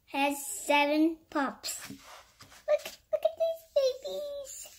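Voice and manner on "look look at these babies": tiny, quick squeal over babies; high-pitched cooing. Real, not acted. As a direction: coo very high at something cute.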